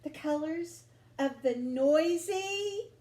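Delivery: plain reading voice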